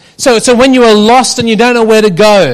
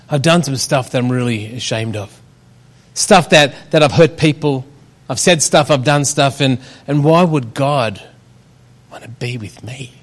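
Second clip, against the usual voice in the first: quiet; 6 semitones lower, 5 dB quieter than usual. Pretend. Voicing an ashamed listener.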